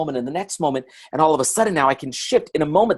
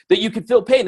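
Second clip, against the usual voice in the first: higher-pitched, imitated volunteer; eager audience answer; 6 semitones higher, 4 dB louder than usual. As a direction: call it out like an eager participant.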